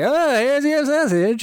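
very high, drawn-out singsong; mock cheery handover. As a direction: stretch it out in a silly high voice. Acting out shoving sausage at someone.

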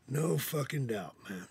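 ordinary talk, nothing marked

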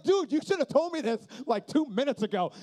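annoyed at the late warning, loud; exasperated. Retold or imitated. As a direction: scold your friend for telling you late.

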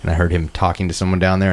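ordinary talk, nothing marked

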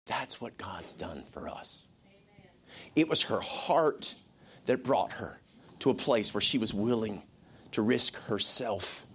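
normal talking voice

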